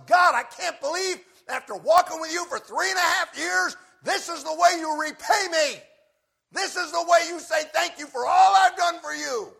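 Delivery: preacher yelling a furious complaint at God; screaming. Retold or imitated. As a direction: scream it at the top of your lungs, outraged. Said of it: "he's screaming at the top of his lungs".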